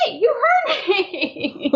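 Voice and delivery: high, laughing mock apology. Guide to a ghost who just answered her, laughing. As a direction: laugh it off nervously, half scared. Broom flew back after teasing.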